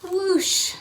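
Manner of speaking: high whoosh. Pretend. Spaceship sound effect.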